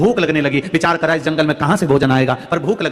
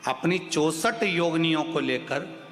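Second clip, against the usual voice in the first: soft, slow, measured teaching; hushed, unhurried preacher; calm devotional instruction; 2 semitones lower, 12 dB quieter, slower than usual. Real, not acted. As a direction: give ritual instructions quietly, with pauses between phrases.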